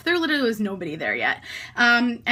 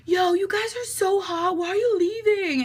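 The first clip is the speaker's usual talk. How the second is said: lightly voiced guys calling out, pitched up; imitating random guys catcalling, high; mock dudebro voice; 8 semitones higher than usual. Guys at the bar calling to the girls, mock dudebro voice.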